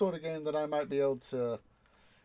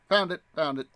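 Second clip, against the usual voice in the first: louder, quick pleased found it, found it; a little burst of triumph; excited; 3 semitones higher, 7 dB louder, faster than usual. Real, not acted. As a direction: pounce on it, pleased you found it. Excited on finding the trailer.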